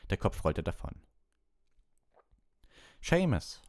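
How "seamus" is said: high, loud call of a name. A narrator's reading. Lupin summons Seamus to his turn, calling out. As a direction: call the pupil up sharply. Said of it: "rief Professor Lupin".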